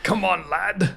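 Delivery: high-pitched put-on sailor call; playfully mock a salty sailor calling his mate; Wake's old sailor. Imitating Wake calling Ephraim lad.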